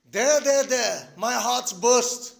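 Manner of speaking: loud, slow dramatic cries of collapse; having an attack. Acted. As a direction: act out a hammed-up heart attack. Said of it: "he has had a full-fledged attack".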